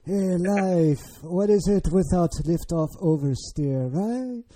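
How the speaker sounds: hammed-up French accent, rising on the last word; terrible French accent. Host as a French engineer philosophising on oversteer, bad French accent. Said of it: "That's a terrible French accent, but, like".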